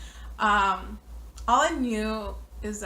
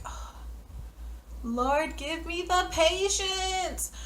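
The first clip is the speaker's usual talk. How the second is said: exasperated sigh to heaven; 8 semitones higher, slower than usual. Retold or imitated. Exasperated prayer among drunk friends.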